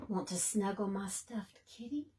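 soft breathy whisper, a bit quick; gentle whisper. A narrator's reading. Rabbit whispering an offer of her toy to Bird. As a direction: offer it like a secret comfort.

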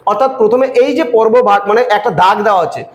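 usual speaking voice

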